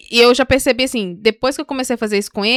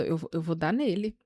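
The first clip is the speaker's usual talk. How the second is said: low, very soft grumble; muttered threat; 5 semitones lower, 11 dB quieter than usual. Retold or imitated. Retelling her talk with God, muttered threat about the child. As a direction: grumble it privately under your breath.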